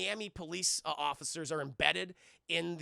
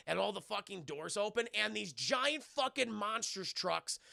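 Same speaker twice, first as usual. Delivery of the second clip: ordinary talk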